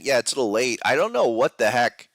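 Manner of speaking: ordinary delivery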